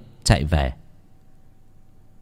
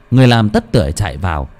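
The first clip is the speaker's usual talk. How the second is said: ordinary delivery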